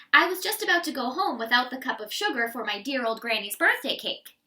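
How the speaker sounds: ordinary delivery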